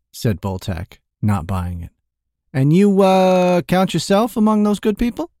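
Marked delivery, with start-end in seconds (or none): 2.5-5.3 higher and louder, mock disbelief; mocking merchant. A narrator's reading. Merchant teasing the thief about being good, mocking higher voice. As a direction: ham up the doubt.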